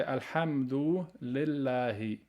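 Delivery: slow, clear Arabic recitation of "Alhamdu lillahi"; careful recitation. Shown as a demonstration. Teacher modelling the phrase for students, slow and clear.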